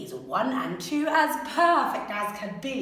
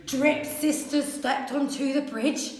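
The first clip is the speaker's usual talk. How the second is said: ordinary delivery, nothing marked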